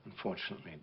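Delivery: usual speaking voice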